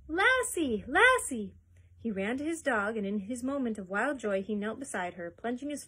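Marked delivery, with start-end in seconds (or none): 0.1-1.5 high, loud joyful cry; boy shouting for his dog; shrill calling. A narrator's reading. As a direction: call out to the dog in wild joy. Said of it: "his voice rang shrill".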